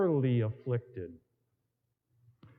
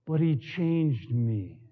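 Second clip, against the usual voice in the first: ordinary talk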